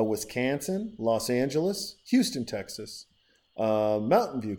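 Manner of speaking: ordinary delivery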